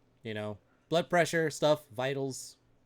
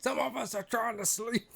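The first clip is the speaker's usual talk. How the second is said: high-pitched mock-annoyed New Yorker; hammed-up heckler, pitched way up; New York attitude; 12 semitones higher than usual. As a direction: complain like a woken neighbour. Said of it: "the New York attitude".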